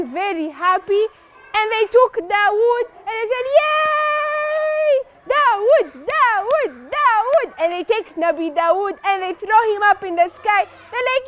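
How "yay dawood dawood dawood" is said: high, long yay into a chant; jubilant cheer. Acted. Happy crowd cheering Dawood's victory, jubilant. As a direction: chant the hero's name in rising-and-falling swoops.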